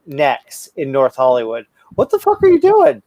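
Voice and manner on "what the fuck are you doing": sudden high, loud challenge; real high-pitched exclamation; startled outburst. Real, not acted. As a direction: break off and yelp at your friend in disbelief. Breaks off story to challenge co-host.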